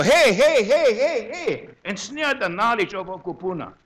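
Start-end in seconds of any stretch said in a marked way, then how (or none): 0.0-1.7 calling out hey. Retold or imitated. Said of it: "I go, I go".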